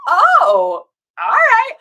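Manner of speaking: loud, exaggerated dawning realization; imitated surprised acceptance. Best friend learns viewers talk through text, loud exaggerated acceptance. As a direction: swoop up high into a mock-accepting reply.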